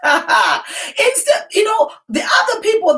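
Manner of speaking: laughing high shout. Real, not acted. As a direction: burst out laughing mid-sentence.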